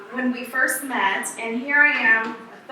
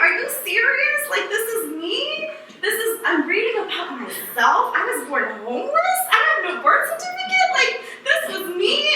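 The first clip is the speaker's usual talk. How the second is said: high, incredulous questions with laughter; stunned disbelief; 8 semitones higher than usual. Retold or imitated. Reading her own refugee records, laughing in high disbelief. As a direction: ask as if it can't be true.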